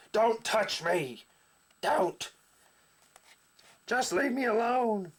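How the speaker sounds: a cornered hero snarls at her; snarling. Acted. Grayscale snarling at Felicity after using instant karma, angry high snarl. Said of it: "he turns around kind of snarling".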